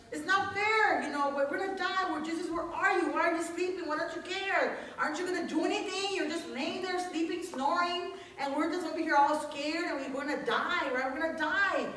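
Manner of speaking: high, loud, frantic pleading; panicked disciples. Retold or imitated. Preacher voicing disciples begging sleeping Jesus, high panic. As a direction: cry out like terrified men in a storm.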